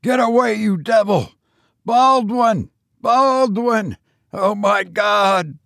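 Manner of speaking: a man calling out in mortal panic; terrified screams. A narrator's reading. Cousin screaming for Baldwin while attacked, high panic. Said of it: "he screamed".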